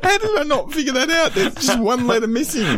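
excited disbelief. Real, not acted. Realises the rude pun, laughing.

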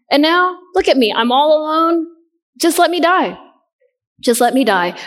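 high, pleading cry of a worn-out prophet; voicing Elijah's despair. Preacher voicing Elijah begging God to let him die, high and pleading. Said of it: "Elijah said".

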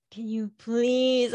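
slow, drawn-out plea; husband pleading. Retold or imitated. Husband asks his emotional wife to please calm down, slow pleading. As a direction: beg her patiently. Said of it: "he'll be like".

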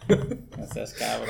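slow words through a laugh; laughing as he scolds his friend. Real, not acted. Friends joking about a game, laughing slowly. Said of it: "laughing reaction".

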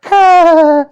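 high, loud drawn-out toy wail; toy Elmo's cry. Retold or imitated. Storyteller imitating a toy that started by itself, high loud cry. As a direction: let out a long piercing held "ah". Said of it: "Tickle Me Elmo started going".

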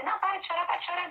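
scolding parent. Retold or imitated. Parent telling a crying child to stop, mock scolding. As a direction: imitate an impatient parent cutting off the tears.

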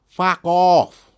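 a loud, high "fuck off", half joking; playful loud brush-off; loud mock retort. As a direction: bark it out in fun.

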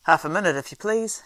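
loud, busy call back; hurried innkeeper shout; shouting over his shoulder. A narrator's reading. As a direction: shout it while rushing past. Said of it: "shouted the man over his shoulder".

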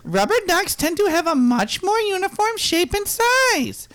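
high Ernie voice. Acted. Ernie-style voice reading facts.

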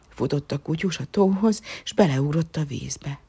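plain reading voice

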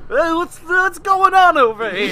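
high, slow, goofy casual greeting. Retold or imitated. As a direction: drawl it high like a sitcom neighbor. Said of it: "Or it's like".

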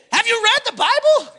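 high-pitched, incredulous question; acted-out exasperated comeback; incredulous retort. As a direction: throw it back in disbelief. Incredulous retort to his critics.

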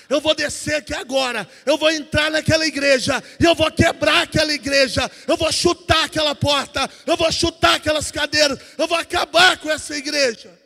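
loud, escalating rant of a furious father; acted-out outburst, building to a shout; angry threat. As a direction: hurl each threat harder, retelling the rage. Retold furious threat to church.

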